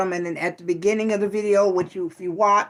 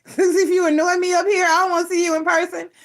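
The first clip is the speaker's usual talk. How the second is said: teasing warning; 9 semitones higher, faster than usual. Real, not acted. Teasing viewers who annoy her.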